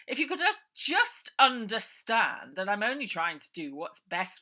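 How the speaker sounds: loud, oily menacing uncle voice; slithering villain. A narrator's reading. Uncle Arthur cornering Ida, slick and menacing.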